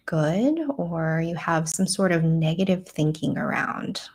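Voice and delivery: calm, measured meditation voice with long pauses; guided meditation. Real, not acted. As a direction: guide them gently inward, leaving space to breathe.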